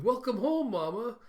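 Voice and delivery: put-on high, loud child's welcome; childlike greeting. Anya greets her mama, in an imitated child's high voice. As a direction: imitate a child calling out a high-pitched hello.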